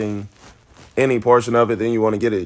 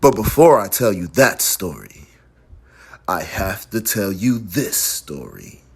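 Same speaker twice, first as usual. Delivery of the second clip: story narration; 5 semitones lower than usual. Shown as a demonstration. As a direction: narrate it like a story, pausing midway and sinking lower. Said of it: "But this is a story, so it's".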